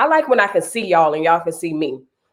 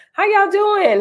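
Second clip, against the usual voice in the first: much higher, singing the question; high greeting; slower than usual. Real, not acted. Host asks her viewers how they are, pitched way up. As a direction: lift it way up on y'all.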